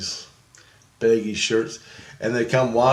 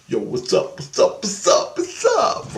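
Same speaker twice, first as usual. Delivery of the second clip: mock homie greeting; 11 semitones higher than usual. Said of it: "I was supposed to come up to them and go".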